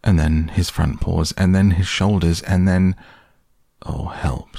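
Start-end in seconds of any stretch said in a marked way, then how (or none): 3.8-4.6 breathy, hushed plea; whispered cry for help. Acted. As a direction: whisper it in a small panic. Pooh stuck in the hole.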